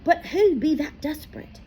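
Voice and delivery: high, voiced, puzzled boy; wondering aloud. A narrator's reading. Harry asking Firenze aloud, high and puzzled. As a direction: ask it out loud, troubled. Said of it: "he wondered aloud".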